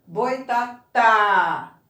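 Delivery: Boitatá very slowly, with a loud, long final TÁ; stress on last syllable. Shown as a demonstration. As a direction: punch and hold the TÁ in Boitatá. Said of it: "Qual é a sílaba pronunciada com mais força?".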